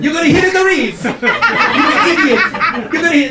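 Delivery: high, exasperated Italian warning; hammed-up Italian accent. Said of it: "That's an Italian accent".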